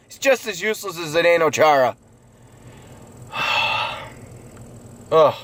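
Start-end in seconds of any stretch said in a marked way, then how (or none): 3.3-4.0 weary sigh. Real, not acted. Frustrated fan venting.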